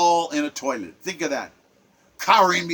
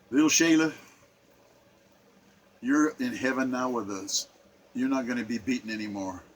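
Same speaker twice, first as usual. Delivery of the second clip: much softer, hushed and gentle; tender farewell; 8 semitones lower, 8 dB quieter than usual. Real, not acted. Telling little Shayla she is safe in heaven, tender and hushed. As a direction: speak softly to her as if she can hear. Speaking to the dead child.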